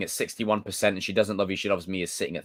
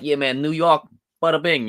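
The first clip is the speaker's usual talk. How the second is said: higher, louder mock New York accent; 6 semitones higher, 5 dB louder than usual. Streamer imitates Frankie's accent, thick mock New York. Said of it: "you have a thick New York accent".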